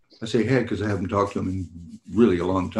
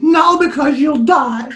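high, loud mock cry of alarm, slowed; shrill up and yell her warning in play; retold shout; 7 dB louder, slower than usual. Wife warning him off, high mock shout.

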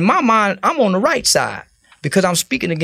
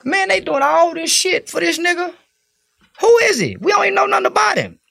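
much higher, incredulous, ending in a shout; retold disbelief; 11 semitones higher than usual. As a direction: play puzzled outrage over the fuss about a stranger. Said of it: "I'm back home saying".

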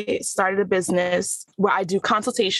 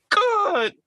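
cry. Acted. Monologue's emotional peak.

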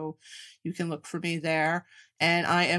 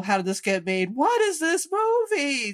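railing to the heavens; 8 semitones higher than usual. Retold or imitated. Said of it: "railing to the heavens".

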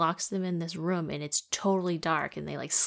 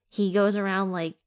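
ordinary delivery

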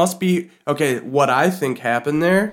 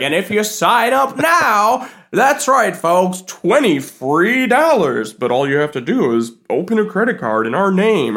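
loudspeaker announcer; 5 semitones higher than usual. Retold or imitated. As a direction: sell it like a hyped announcer. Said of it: "they come over a loudspeaker".

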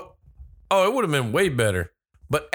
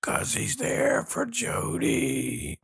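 hammed-up slow, soft whisper; drawn-out spooky mock whisper; creepy whisper; 6 semitones higher, 5 dB quieter, much slower than usual. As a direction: whisper it slowly like a movie creep. Hammed-up voice for masked killer.

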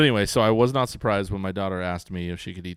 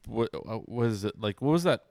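ordinary talk

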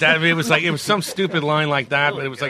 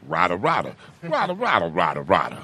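singsong nonsense with pitch swings; cartoon gibberish; 7 semitones lower than usual. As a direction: babble it like a silly cartoon. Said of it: "all I was saying was".

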